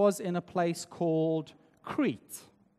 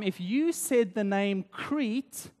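ordinary talk, nothing marked

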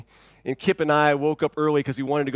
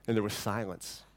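usual speaking voice

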